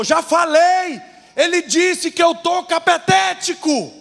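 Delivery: high, shouted comic exasperation; irritated outburst. Acted. Grandfather fed up with his wife's questions, acted angry shout. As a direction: blow up in mock anger, stretching the last words. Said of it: "ele ficou nervoso e falou".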